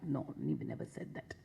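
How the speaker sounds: soft whispered denial; a hushed, firm no. Real, not acted. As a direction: breathe it out almost silently. Denying forced worship.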